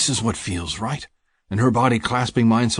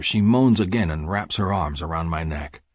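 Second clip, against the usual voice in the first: plain reading voice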